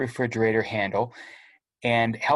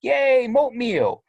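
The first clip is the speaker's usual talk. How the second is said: put-on high, slow childish cheer; excited kid; 11 semitones higher, 4 dB louder, slower than usual. Little brother celebrating his oatmeal win, high and drawn out. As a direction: cheer it like a delighted little boy.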